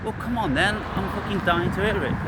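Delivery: ordinary talk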